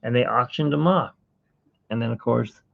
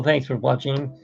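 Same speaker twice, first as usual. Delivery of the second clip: ordinary delivery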